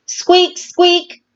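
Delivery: high squeaking sound; high-pitched squeak squeak; squeaky creak. A narrator's reading. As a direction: squeak like a rusty ride. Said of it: "merry-go-round sound".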